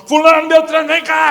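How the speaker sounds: loud, high yell across the house; father shouting for his children; calling out. Retold or imitated. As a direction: summon the kids angrily. Husband yelling for his children.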